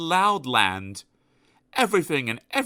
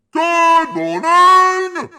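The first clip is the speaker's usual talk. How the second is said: slow, much higher, very loud greeting; bellowing grocer; 11 dB louder, much slower than usual. Acted. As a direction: boom it across the shop. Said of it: "bellowed the grocer".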